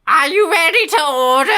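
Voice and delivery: high, loud, strained witch shriek; wicked witch. Pretend. Waitress takes the order as a wicked witch, high and loud. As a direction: screech it like the Witch of the West. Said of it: "canonically the Witch of the West".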